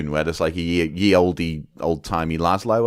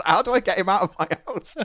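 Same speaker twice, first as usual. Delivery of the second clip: panicked homeowner; 10 semitones higher than usual. Retold or imitated. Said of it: "And it's".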